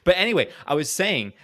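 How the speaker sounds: usual speaking voice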